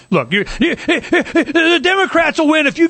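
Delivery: laughing through a mock retort, slowed; half laugh it while quoting yourself; a laughing, slowed-down quoted comeback. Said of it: "I go".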